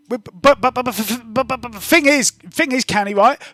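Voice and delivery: put-on high, loud, rapid babbling kid voice; excitable girl Spring. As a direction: gush breathlessly like an over-eager street kid. GM keeps Spring's voice.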